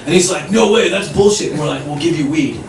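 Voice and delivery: normal talking voice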